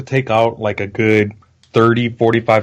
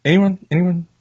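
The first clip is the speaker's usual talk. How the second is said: mock plea; 6 semitones higher than usual. Mocking silence after joke.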